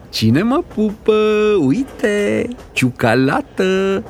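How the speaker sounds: high, drawn-out singsong offer; playful coaxing. Acted. Mother tempting her boy with chocolate, playful coaxing. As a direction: dangle the treat in a teasing singsong.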